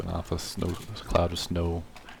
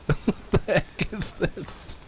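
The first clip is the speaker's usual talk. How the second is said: laughing; 10 semitones higher, slower than usual. Real, not acted. Laughing at being stuck.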